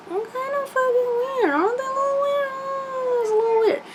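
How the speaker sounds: very high, put-on squeaky voice; sing-song it up high, mocking; teasing falsetto commentary; comic falsetto aside.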